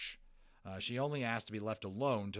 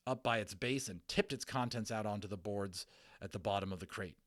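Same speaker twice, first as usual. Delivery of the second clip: usual speaking voice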